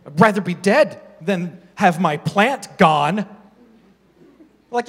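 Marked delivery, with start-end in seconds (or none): 0.1-3.3 mock whiny Jonah. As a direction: lightly parody a sulking Jonah. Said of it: "where he's like".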